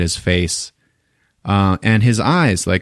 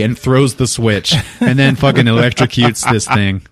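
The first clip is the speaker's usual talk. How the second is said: ordinary talk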